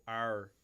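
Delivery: "Arr" drawn out with r colouring; Australian vowel. Shown as a demonstration. Coaching a reluctant co-host, "Arr" as Australian vowel. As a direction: stretch "Arr" like Australian "naur".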